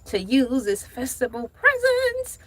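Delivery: preacher cadence, punctuated with "ha"; Reverend Archibald Turner. Acted. Host reading Target's plan as a reverend, preacherly cadence. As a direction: read it like a sermon, huffing after each phrase. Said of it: "like Reverend Archibald Turner".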